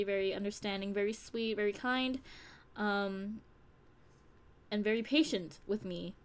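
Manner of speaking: normal talking voice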